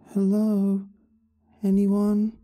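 put-on high, distant frightened girl calling out; little girl voice. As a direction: call out like a scared young girl far away. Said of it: "sounded like a young girl calling".

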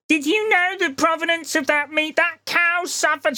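very high, drawn-out mocking voice; preachy vegetarian impression. Vegetarian lecturing a diner about the meat, mock preachy.

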